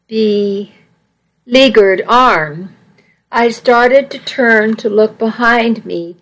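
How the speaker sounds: ordinary delivery, nothing marked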